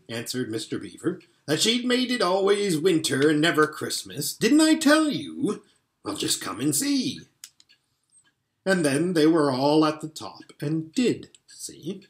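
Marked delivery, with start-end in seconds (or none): 1.5-7.2 gleeful. Acted. Beaver gleeful, told them so.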